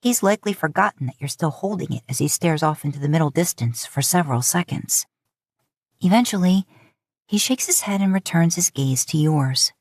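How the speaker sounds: ordinary delivery, nothing marked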